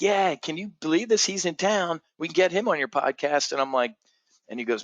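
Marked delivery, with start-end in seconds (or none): 0.0-3.5 high-pitched imitation of an excited buddy; gushing with eager disbelief; thrilled. Friend saying the guest is in town, thrilled.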